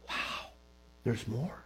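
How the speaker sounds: low, hushed retold wonder; awed thought. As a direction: think it aloud softly after a pause. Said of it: "I paused. I thought".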